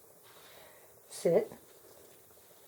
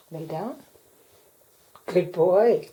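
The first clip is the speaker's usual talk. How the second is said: ordinary talk, nothing marked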